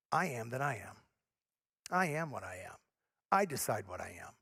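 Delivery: low and soft, mock self-important; proud self-definer. Voicing prideful self-definition.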